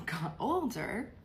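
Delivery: usual speaking voice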